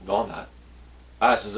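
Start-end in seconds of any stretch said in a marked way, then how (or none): 0.1-0.5 quiet denial. Retold or imitated. Denies yelling, speaks softly.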